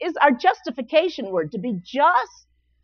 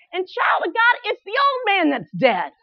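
ordinary talk, nothing marked

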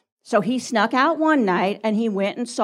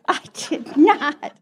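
slow, higher-pitched indignant denial; laughing mock protest; 5 semitones higher, much slower than usual. Real, not acted. Wife teased by husband before the congregation, laughing indignant denial. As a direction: deny it with amused outrage.

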